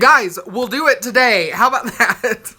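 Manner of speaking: laughing announcement. Real, not acted. Telling viewers we'll do it today, laughing. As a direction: call it out through laughter.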